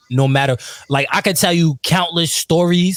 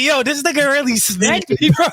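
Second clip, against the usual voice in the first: laughing disbelief; 9 semitones higher than usual. Retold or imitated. Retelling shock after being smacked.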